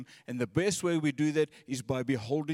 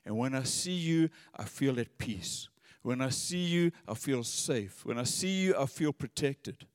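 softer and lower, each line settling down; soft longing prayer; 3 semitones lower, 3 dB quieter than usual. Acted. David speaking tenderly to the Lord, soft and low. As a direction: pray it quietly, longing to see God. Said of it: "His emphasis is".

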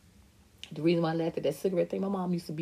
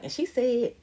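high, quick chattering gossip voice; mom gossiping on the phone; 10 semitones higher than usual. Retold or imitated. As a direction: imitate mom running her mouth to her friends. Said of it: "That's how I got these voices, 'cause she be like".

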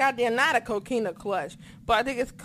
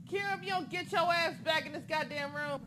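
higher, soft scold; scolding the dog; 5 semitones higher, 8 dB quieter, faster than usual. Real, not acted. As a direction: call the nosy dog back. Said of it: "I have to yell at my damn dog".

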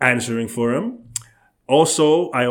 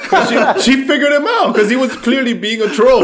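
laughing; 11 semitones higher, 6 dB louder than usual. Real, not acted. Laughing teasing high.

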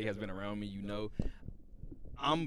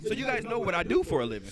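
sarcastic; 9 semitones higher, 6 dB louder than usual. Retold or imitated. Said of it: "and I was like".

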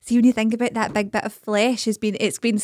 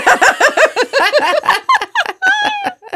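shrieking laugh, much higher; long high laugh; 5 dB louder than usual. Real, not acted. After "they can fuck off", long high laugh.

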